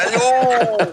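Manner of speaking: put-on high, slow, sing-song baby talk; talking to a toddler. Shown as a demonstration. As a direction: speak like to a three-year-old. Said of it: "comme si je parlais à un enfant de trois ans". (voix pour parler à un bambin)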